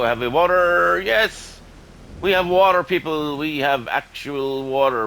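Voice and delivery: high, loud delighted cheer; excited. Real, not acted. Gamer cheering to viewers about finding water, excited and loud.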